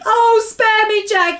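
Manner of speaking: high, drawn-out pleading yell. A narrator's reading. Giant begging Jack for mercy, yelled. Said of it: "he yelled".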